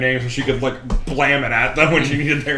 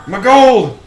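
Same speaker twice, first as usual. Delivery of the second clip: high, loud cry of alarm; alarmed shout; 9 semitones higher, 10 dB louder than usual. Retold or imitated. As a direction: yell it as if robbed. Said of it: "And then you're like".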